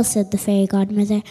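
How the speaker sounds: ordinary delivery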